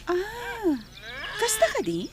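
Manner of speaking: high-pitched surprised question; surprised interest. Acted. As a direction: take in the news, voice lifted high. Surprised at old friendship news. (masdaaw a agsaludsod)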